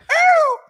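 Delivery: high, loud happy whoop; excited whoop. Real, not acted. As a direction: let out a delighted whoop. Cheering the driver's win.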